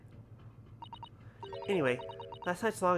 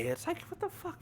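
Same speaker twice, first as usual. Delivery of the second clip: much higher, incredulous what the fuck; exasperated outburst. Real, not acted. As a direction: throw it up in disbelief. Exasperated at hungry cat.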